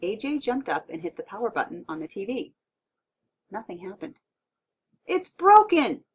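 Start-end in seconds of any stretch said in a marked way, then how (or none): 5.1-6.0 loud, high cry of dismay; exclaimed. A narrator's reading. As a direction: exclaim it as a shocking discovery. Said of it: "he exclaimed".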